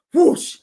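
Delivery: whoosh sound. Retold or imitated. Imitating fire going up.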